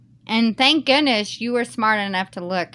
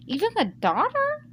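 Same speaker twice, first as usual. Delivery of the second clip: shocked; 13 semitones higher than usual. Real, not acted. Shocked reaction to husband's insult.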